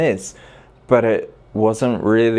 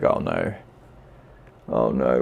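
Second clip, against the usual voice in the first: low, soft sinking oh no; quiet lowered voice, reliving the panic; mock dread; 11 semitones lower, 5 dB quieter than usual. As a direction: let it sink as the dread hits. Realising mum overheard him.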